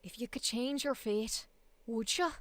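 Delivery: lilting Scottish accent; Scottish accent as Merida. Shown as a demonstration. Streamer demoing her Scottish from a memorized piece, Scottish accent. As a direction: do a film heroine's Scottish burr. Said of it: "I can do it from the Merida piece".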